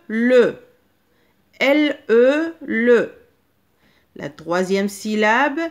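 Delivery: loud, deliberate dictation voice; slow dictation. Shown as a demonstration. Teacher dictates syllables to children, loud and deliberate. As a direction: dictate each syllable twice for the class. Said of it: "les syllabes que je vais vous dicter".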